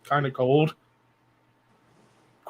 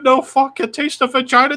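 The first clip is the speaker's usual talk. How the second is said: mock Chinese accent; 9 semitones higher, 4 dB louder than usual. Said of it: "the Chinese food bit".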